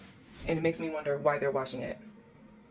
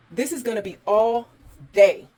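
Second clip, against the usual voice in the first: loud wail stretched on day; exasperated wail; 5 semitones higher, 11 dB louder than usual. Real, not acted. Host facing a full day of cleaning, loud exasperated wail. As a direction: groan it out in despair.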